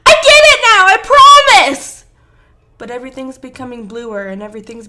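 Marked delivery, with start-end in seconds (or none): 0.1-2.1 high, pleading scream at full volume; desperate scream. A narrator's reading. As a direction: scream it out, stretching the last words. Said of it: "he screams".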